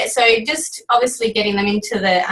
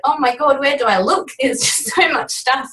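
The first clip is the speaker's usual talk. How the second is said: higher-pitched, laughing retold fluster; mock overwhelmed; 6 semitones higher than usual. Recruit recalls her confused start with the team, mock overwhelmed. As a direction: act out being swamped, laughing at yourself. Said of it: "um, overwhelmed. Now, I know I did".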